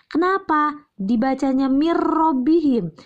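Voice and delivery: usual speaking voice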